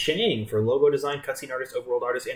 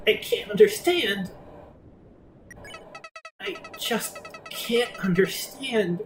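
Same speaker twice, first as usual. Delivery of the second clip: higher, pained and faltering; distraught bewilderment; 10 semitones higher, slower than usual. Acted. Villain who can't understand being spared, distraught. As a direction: plead helplessly, slowing as it sinks in. Flowey breaking down at mercy.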